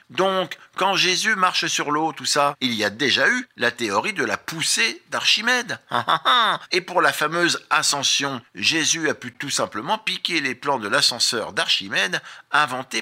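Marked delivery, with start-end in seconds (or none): 5.8-6.7 higher-pitched staged chuckle; short string of ha-ha-has; mock laugh. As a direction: laugh smugly at your own theory. Laughs at own Jesus theory.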